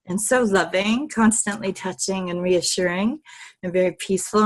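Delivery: ordinary talk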